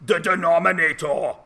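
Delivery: hammed-up Austrian action-hero accent, heavy Austrian vowels; mock Austrian bodybuilder accent, a bad one; bad Arnold Schwarzenegger accent. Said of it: "in a bad Arnold Schwarzenegger accent".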